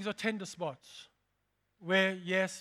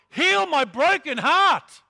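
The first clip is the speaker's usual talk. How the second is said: anguished prayer; 6 semitones higher, 11 dB louder, slower than usual. Pretend. Prayer cry to God.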